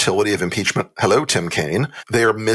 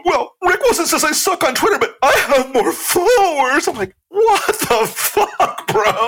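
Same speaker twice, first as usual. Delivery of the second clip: mocking Gaetz whine. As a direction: squeal it like a whiny teenager. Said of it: "he's like".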